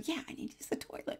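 quick hushed whisper, still discreet; whispered reply. Retold or imitated. Whispering back to the woman during the meeting. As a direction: keep it to a fast, soft whisper. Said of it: "and I whispered over the".

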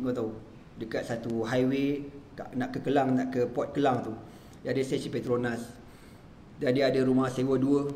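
ordinary talk

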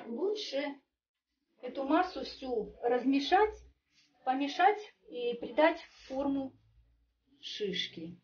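ordinary talk

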